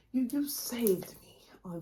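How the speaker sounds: hushed, breathy gratitude; whispered thanks. Real, not acted. Thanking Kevin directly to the camera, whispered.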